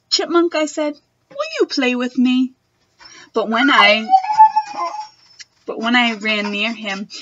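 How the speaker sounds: put-on expressive read-aloud for children; reading a picture book to a class; storybook reading. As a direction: read it like story time for little kids.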